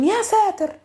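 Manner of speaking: high, acted cry of alarm; startled exclamation. Shown as a demonstration. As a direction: react as if to a big explosion. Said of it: "a big explosion".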